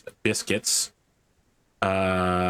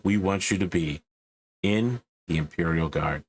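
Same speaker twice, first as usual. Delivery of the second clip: recruitment ad; 2 semitones lower than usual. Acted. GM reading a grim Imperial Guard recruitment ad, solemn. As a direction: sell the grim recruitment pitch.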